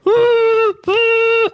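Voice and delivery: very high, whimpering dog whine; imitated dog whine. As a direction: whine like the dog through the headphones. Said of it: "I can hear it".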